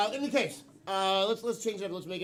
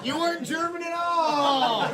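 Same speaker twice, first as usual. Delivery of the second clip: high, loud accusation; shouted game-show verdict; mock outrage; 10 semitones higher, 11 dB louder than usual. Real, not acted. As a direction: call out the mistake at full volume. Host calls out the wrong accent.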